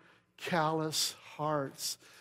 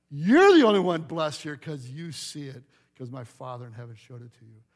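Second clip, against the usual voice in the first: ordinary delivery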